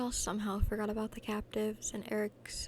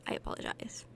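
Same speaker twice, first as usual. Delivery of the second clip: ordinary delivery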